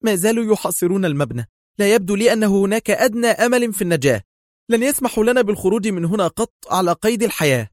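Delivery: high-pitched, anxious urgency; very tense. A narrator's reading. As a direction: voice pushed high with extreme nervous tension. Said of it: "في توتر بالغ".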